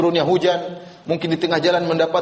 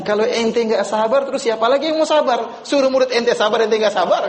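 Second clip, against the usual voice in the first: retold scolding; 7 semitones higher than usual. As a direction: imitate a friend's exasperated scolding, pitched up. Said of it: "saya di-, diomelin sama dia". (menirukan omelan teman dengan nada tinggi)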